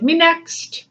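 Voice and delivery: high eager mock plea; past lives clamoring. Past lives begging to go next, high and eager. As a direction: squeak it out like a pushy child. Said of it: "your past lives are like".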